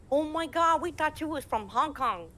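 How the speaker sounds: high, loud mock astonishment; amazed fans. Fans learn Ping Ting is American, high astonished imitation. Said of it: "And they're like".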